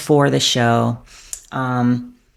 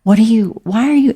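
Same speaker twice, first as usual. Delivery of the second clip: loud, much higher, incredulous cry; baffled outburst; 9 semitones higher, 7 dB louder than usual. Retold or imitated. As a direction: burst out in disbelief at strangers signing at you. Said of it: "And I'm like".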